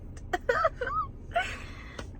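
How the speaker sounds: squeaky embarrassed giggle; giggling at her own blooper; high giggle. Real, not acted. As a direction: break into a high, helpless giggle. Laughing at blooper.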